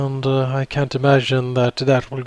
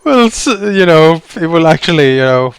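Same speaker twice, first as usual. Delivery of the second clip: laughing; 4 semitones higher, 9 dB louder, slower than usual. Real, not acted. Laughing while narrating.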